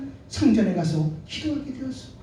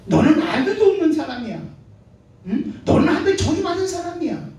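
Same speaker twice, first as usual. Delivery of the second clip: mocking rival; 7 semitones higher than usual. Rival taunting childless Hannah.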